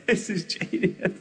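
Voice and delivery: trying not to laugh while hiding; giggling whisper. Retold or imitated. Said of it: "I was going, laughs".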